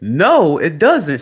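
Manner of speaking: high, loud, acted-out denial; retold loud protest. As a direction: shout the child down, replaying the argument. Acted argument with child.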